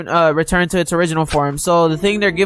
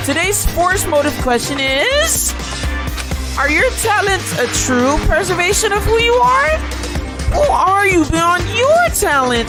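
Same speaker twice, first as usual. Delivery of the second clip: sing-song menace like a game show villain; fighting game announcer; 9 semitones higher than usual. Acted. Plays the Killing Game announcer.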